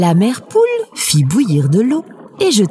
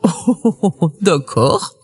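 petit rire de loup « oh, oh, oh »; souriant. A narrator's reading. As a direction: accepte avec un rire feint, bonhomme. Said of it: "répondit en souriant". (loup amusé qui répond en souriant)

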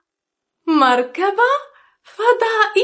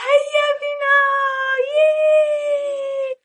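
excited cheer; 9 semitones higher than usual. Acted. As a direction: cheer like a child. Cheer before trip.

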